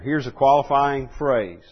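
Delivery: normal talking voice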